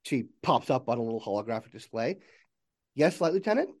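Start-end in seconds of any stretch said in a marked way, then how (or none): 2.9-3.7 lightly voiced helpful AI, pitched up; higher-pitched computer assistant asking to serve; a hint of a virtual assistant. Game master voices the AI assistant.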